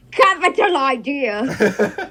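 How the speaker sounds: put-on very slow, loud grand British accent. Co-host contrasts the coaches with a slow, grand British capital idea. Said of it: "He's like".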